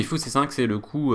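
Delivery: usual speaking voice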